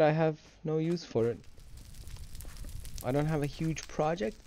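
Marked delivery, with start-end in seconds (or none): none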